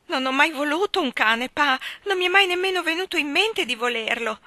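high, loud tearful protest. A narrator's reading. Mrs Taylor tearfully assuring her husband, high pitched. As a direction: plead through tears. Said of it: "tra i singhiozzi".

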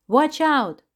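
high, loud shout of warning. Acted. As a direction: yell to warn him. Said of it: "Amita cried".

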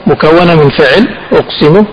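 normal talking voice

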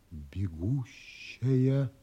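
spelling out the name slowly, in awe; reading the ship's name in quiet wonder; slow reading aloud. A narrator's reading. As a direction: read the gold letters slowly, one by one.

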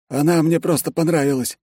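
high-pitched, candid character voice; smitten secretary. A narrator's reading. Secretary admitting he likes the wife, high and candid. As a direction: confess it high and simply.

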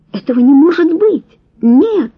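high, loud horrified outburst; horrified cry. A narrator's reading. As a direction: cry out in disbelief. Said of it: "захлебнулась она".

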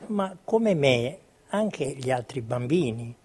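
ordinary talk

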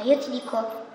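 ordinary talk, nothing marked

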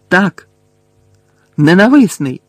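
loud, high burst of bitter exclamation; high, cracked shout; cracked-voice outcry. A narrator's reading. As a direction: cry it out, voice rising sharply then falling. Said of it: "надтріснутим голосом вигукнув Гаррі".

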